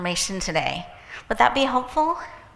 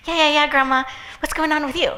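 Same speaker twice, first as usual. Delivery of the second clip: brushing Grandma off; 6 semitones higher, 4 dB louder, faster than usual. Retold or imitated. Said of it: "But I had stuff to do".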